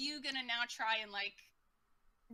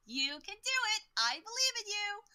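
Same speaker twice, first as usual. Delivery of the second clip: high, perky cheering; root for them like an overeager fan; cheerleader; 9 semitones higher, 4 dB louder than usual. Acted. [name] cheering the party across the gap, perky cheerleader. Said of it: "our own cheerleader".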